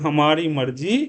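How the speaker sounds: usual speaking voice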